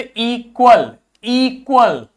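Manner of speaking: long "ee" opening इक्वल, said slowly; long E sound. Shown as a demonstration. Teacher shows double E, long "ee" in इक्वल. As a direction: draw out the "ee" at the start of इक्वल. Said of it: "ई sound हो जाएगा".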